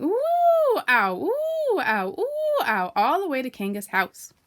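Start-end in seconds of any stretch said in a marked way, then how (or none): 0.0-2.9 very high, loud bouncing cries; ooh ow cries. A narrator's reading. Piglet bounced all the way to Kanga's, high cries. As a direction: cry out with every bounce. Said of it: "he was saying".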